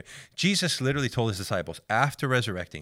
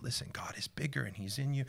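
whispered and gentle; hushed reassurance; 5 semitones higher, 7 dB quieter than usual. Retold or imitated. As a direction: whisper comfort to a frightened child. Comforting scared girl.

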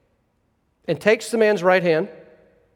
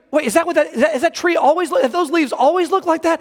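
higher-pitched, stumbling astonished questions; retold disbelief; 8 semitones higher than usual. Older man seeing true colors for the first time, imitated disbelief. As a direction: act out someone amazed, restarting the question as it tumbles out. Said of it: "he's just in disbelief".

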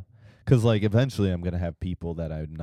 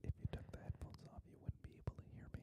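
whispering; 24 dB quieter, much faster than usual. Real, not acted. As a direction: close mic whisper. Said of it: "I can whisper to you".

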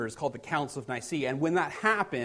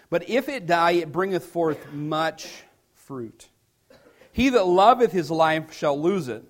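louder, measured King James reading with rising emphasis; scripture reading; 5 dB louder than usual. Acted. Pastor reading John to the church, proclaiming. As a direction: proclaim the verses to the congregation.

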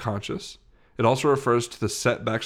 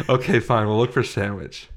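normal talking voice

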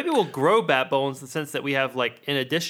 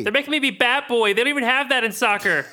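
put-on fast high bat-kid voice; 10 semitones higher, 4 dB louder, much faster than usual.

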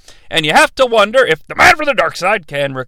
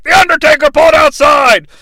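loud, higher commentator shout; imitating a commentator's big shout; announcer's excited call; 7 semitones higher, 5 dB louder than usual. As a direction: call the move like a ringside announcer. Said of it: "he's about to be like".

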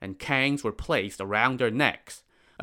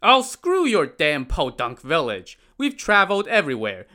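loud, fast, high scornful retort; shooting back; 6 semitones higher, 5 dB louder than usual. Acted. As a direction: fire the insult right back. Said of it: "shot back".

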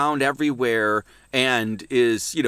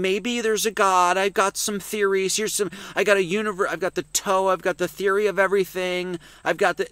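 mocking theorist; 7 semitones higher than usual.

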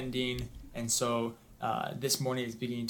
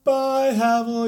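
high, loud, drawn-out shout; called-out goodbye; 11 semitones higher, 10 dB louder, much slower than usual. Acted. Boy waving from covered wagon, calling out. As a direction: holler it back from far down the road.

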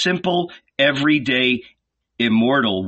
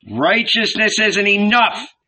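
high, louder protest; angry heckling; 10 semitones higher, 4 dB louder than usual. A narrator's reading. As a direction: cut in angrily. Famished heckler interrupts.

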